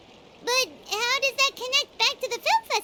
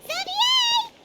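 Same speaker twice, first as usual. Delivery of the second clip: high-pitched call of a name; 13 semitones higher than usual. Acted. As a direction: call out high to get his attention. Calling out to a character.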